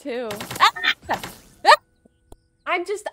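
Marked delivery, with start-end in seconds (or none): 0.6-1.8 short, loud real screams. Real, not acted. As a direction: yelp twice in panic. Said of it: "I can't not scream".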